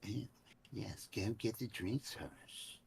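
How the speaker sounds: soft, resentful mimicking grumble; muttering to himself. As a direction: grumble under your breath about an order. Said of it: "muttering to himself".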